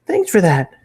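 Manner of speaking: put-on high, bright and bouncy girl voice. A narrator's reading. Pinky thanking Matt after the hug, bouncy and high. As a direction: bounce it up, cheerful. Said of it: "she said in a bouncy tone".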